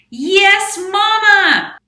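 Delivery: very high, very loud sung-out squeal; loud mouse. Acted. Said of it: "That loud mouse".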